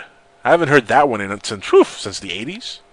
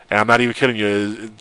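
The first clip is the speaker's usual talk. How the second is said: ordinary delivery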